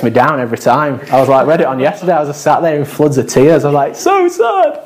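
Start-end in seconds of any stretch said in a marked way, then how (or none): 4.0-4.8 high, wailing mock sob; hammed-up tearful squeak. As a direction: wail it like a weepy child, half joking. Weeping over the Snowman film.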